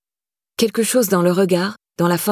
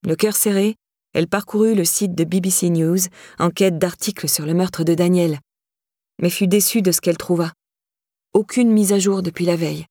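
ordinary delivery

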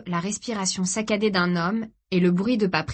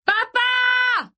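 very high, loud scream for help; long, high-pitched desperate call; all-out shout; 5 dB louder than usual. A narrator's reading. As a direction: yell with all your strength. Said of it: "cria-t-il de toutes ses forces".

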